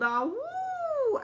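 high, wild howl at the moon; wild things howling at the moon; wolfish howl. Acted. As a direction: throw your head back and howl. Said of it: "And so they howled".

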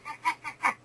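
soft creaky ah sounds in vocal fry. Shown as a demonstration. Lecturer demonstrating vocal fry to her audience, soft creaky ahs. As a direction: rattle out a few creaky ahs. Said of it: "It's like a creaky".

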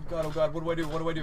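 panicked. Real, not acted. Panicked in a chase.

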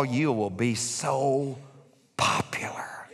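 slow, soft, whispered lure; tempting whisper. Acted. Devil tempting Jesus on the pinnacle, a slow seductive whisper. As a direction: coax him like the devil with a whisper.

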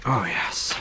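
normal talking voice

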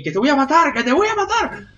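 high-pitched mock threat; imitating own yelling. Gamer imagining a neighbour overhearing him, mock high yell. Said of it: "me escucha ahí".

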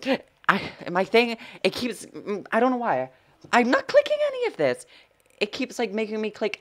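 high-pitched flustered complaint; worked-up, high-voiced frustration; exasperated protest. Real, not acted. Complains game clicks by itself.

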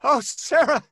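high, loud, laughing with relief; relieved joy. Acted. Husband greeting returned wife, joyful relief.

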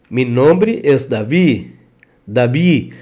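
Davi said dabi, v as b; Spanish Davi with B. Shown as a demonstration. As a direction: soften the v of Davi to a b. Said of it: "o som oficial é B".

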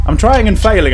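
loud, high frustration; exasperated at own aim; frustrated. Real, not acted.

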